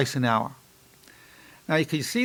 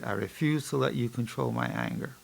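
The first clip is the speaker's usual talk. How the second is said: focused self-talk; 2 semitones lower, 8 dB quieter than usual. Shown as a demonstration. Psychologist modelling focused listening to his mantra, hushed. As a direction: say it quietly, listening to your own voice. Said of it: "I'm listening to my voice as I say it".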